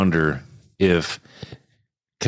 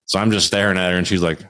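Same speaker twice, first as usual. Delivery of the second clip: normal talking voice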